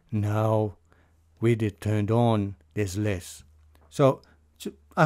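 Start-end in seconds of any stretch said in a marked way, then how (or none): 0.1-3.4 talking low. Shown as a demonstration. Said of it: "I'm trying to talk low".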